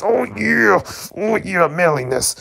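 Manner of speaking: high, hammed-up oh yeah; mock swooning. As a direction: gush over manliness in a high voice. Mock swooning over manliness.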